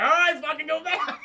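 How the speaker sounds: high, loud mock exasperation; fed-up yell. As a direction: give in with a hammed-up shout. Fed-up friend gives in.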